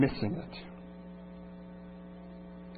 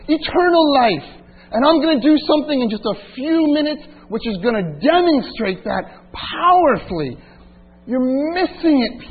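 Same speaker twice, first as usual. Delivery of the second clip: Jesus pleading; 7 semitones higher, 5 dB louder than usual. Acted. Preacher voices Jesus addressing mourners.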